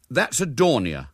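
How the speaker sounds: know-all schoolboy verdict; air of finality. A narrator's reading. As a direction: pronounce it as settled fact. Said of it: "with an air of finality".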